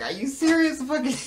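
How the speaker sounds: high, loud replay of disbelief; incredulous. Retold or imitated. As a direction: pitch it way up, amused and appalled.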